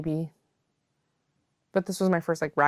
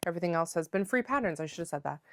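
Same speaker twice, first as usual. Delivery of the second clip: ordinary talk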